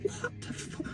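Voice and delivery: voiceless, cut-off whisper of disbelief; whispered swear. Retold or imitated. Dad reacting to kids shouting, whispered disbelief. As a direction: breathe the words out in shock. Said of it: "And I'm like".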